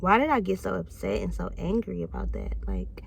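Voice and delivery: unvoiced whisper to herself; hushed self-doubt. Real, not acted. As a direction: breathe the question out with no voice. Self-questioning aside.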